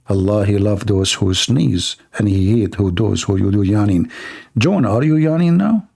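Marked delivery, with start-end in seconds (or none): 0.1-4.1 put-on soothing tone, pitched down; mock calm voice. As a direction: recite it low and unhurried, tongue in cheek.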